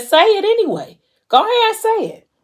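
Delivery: very high-pitched, insistent urging; acted demand. Man demanding Mo confess despite it being wrong, insistent. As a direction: push hard, voice leaping up high.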